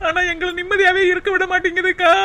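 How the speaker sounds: whining, weepy lament; crying. Acted. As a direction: sob out the grievance. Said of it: "உட்கார்ந்து அழுதுகிட்டு இருக்க".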